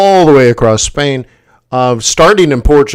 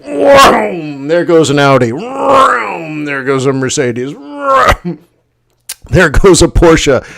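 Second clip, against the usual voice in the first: vroom noises; slower than usual. Retold or imitated. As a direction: mimic cars zooming past. Cars speeding past imitated.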